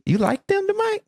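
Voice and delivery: high, judging question; lightly voiced disapproving mother; passive-aggressive mom. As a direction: imitate a mom doubting the kid's choice. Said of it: "a little bit passive aggressive".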